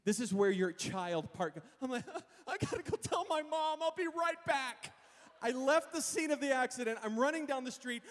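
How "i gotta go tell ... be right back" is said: put-on high, childlike whine; mock baby-voiced panic; scared kid. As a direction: squeal it like a kid fleeing trouble. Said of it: "I'm a baby still".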